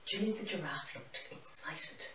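ordinary delivery

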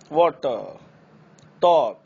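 वाटर, टॉक with a long rounded aw; open aw words. Shown as a demonstration. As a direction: round each aw fully. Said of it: "ना कि हम लोग". (औ वाले शब्द)

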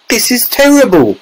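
high-pitched despairing wail. A narrator's reading. Mother despairs over shrunken son, high wail. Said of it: "wailed Missus T V".

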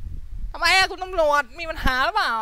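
high, slow, smug taunting voice; roleplay troublemaker baiting a cop, high and smug; cheeky annoying voice. Shown as a demonstration. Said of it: "เสียงกวนๆส้นตีนหน่อยอะ".

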